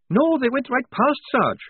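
high, breathless-sounding urgency; urgent report. A narrator's reading. Lofty answering the sergeant about troopers, urgent report.

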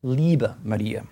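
Liebe Maria, Liebe said slowly as LEE-beh; slow German demo; German word. Shown as a demonstration. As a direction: linger on Liebe. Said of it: "Liebe is the German word for dear".

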